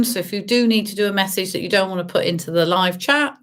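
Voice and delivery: normal talking voice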